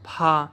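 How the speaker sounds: پھا with a strong puff of breath on the p; teacher showing aspirated p; aspirated pha. Shown as a demonstration. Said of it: "پے ہے الف ہا ہوگا".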